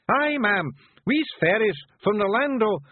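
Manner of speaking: high, rough little Scottish pictie, gently; a tiny Scots warrior breaking bad news; Feegle voice. Rob bluffs Miss Level.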